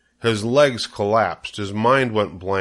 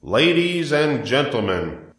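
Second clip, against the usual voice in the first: high, slow, drawn-out address; loudspeaker announcer; 5 semitones higher, slower than usual. A narrator's reading. Announcer addresses the fairgoers, grand slow public-address voice. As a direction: call the crowd to attention like an announcer. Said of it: "continued the loudspeaker".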